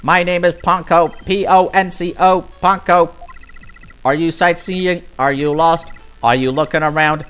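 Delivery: high, bright robot voice; chirpy robot Ponko. Acted. Robot Ponko introducing itself to guests, high and chirpy. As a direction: play the eager little robot guide.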